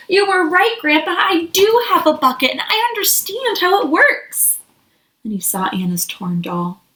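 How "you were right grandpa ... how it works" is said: raised, higher, eager voice; mild excited shout. Acted. Felix bursting in to tell Grandpa, a mild excited shout. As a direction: burst in with the good news. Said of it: "he shouted".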